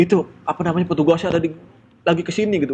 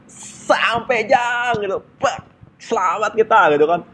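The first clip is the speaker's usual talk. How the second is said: higher, slow cry of relief; half-shouted joy, well above his usual pitch; excited relieved shout; 9 semitones higher, slower than usual. Retold or imitated. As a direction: celebrate reaching home. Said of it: "gua sampai teriak".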